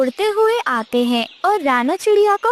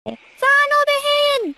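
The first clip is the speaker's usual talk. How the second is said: much higher, slow, drawn-out warning call; shouting warning; 9 semitones higher, much slower than usual. Acted. Parrot calls to the sparrow to come back, slow high warning shout. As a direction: call out across the distance in alarm. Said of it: "मिठू तोता चिल्लाता है".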